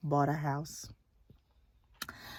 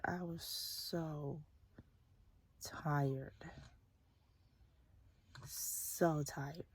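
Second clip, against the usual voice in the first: very slow, much softer, then a whisper; real exhausted whisper; drained whisper; 9 dB quieter, much slower than usual. Real, not acted. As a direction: drag it out, spent. Describing exhaustion.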